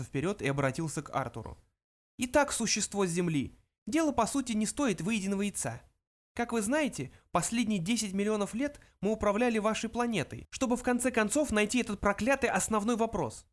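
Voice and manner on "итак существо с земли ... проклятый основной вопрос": high little mouse voice; high-pitched, businesslike small creature; mouse Benjy, businesslike. A narrator's reading. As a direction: explain matters as a tiny mouse. Mouse character explains.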